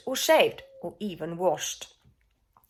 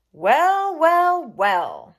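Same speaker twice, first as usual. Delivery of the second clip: a lightly voiced silky villain, loud first well; smug purring schemer; 7 dB louder, much slower than usual. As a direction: savour the discovery like a sly cat. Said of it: "he purred".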